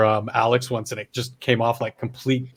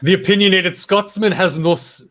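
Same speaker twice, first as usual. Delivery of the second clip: put-on Scottish accent; 6 semitones higher, 4 dB louder than usual. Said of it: "fuck, that was terrible".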